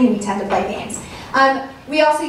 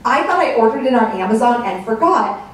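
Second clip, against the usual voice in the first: usual speaking voice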